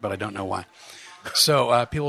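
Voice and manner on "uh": ordinary delivery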